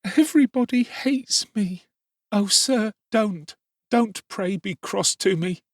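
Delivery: put-on high child's voice, slowing as he begs; boy begging the beadle not to be cross, crying; tearful pleading. A narrator's reading. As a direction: beg through tears. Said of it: "cried the child".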